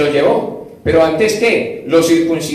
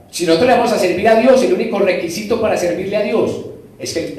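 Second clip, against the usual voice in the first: normal talking voice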